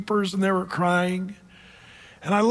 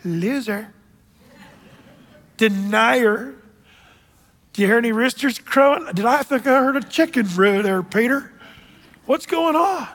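mock scolding taunt, rising high; imagined Jesus mocking Peter, sarcastic taunt; 3 semitones higher than usual. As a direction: taunt him like a sarcastic teacher. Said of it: "Did he go".

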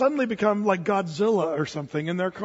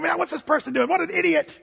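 annoyed driver; 8 semitones higher than usual. Retold or imitated. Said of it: "you're thinking".

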